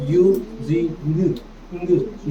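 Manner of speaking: "Using" stretched very slowly, every sound held. Shown as a demonstration. Teacher stretches "using" slowly so students catch the ending. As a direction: draw "using" out syllable by syllable. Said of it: "इसे पढ़ेंगे अपन using".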